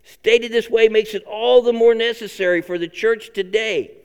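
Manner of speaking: ordinary talk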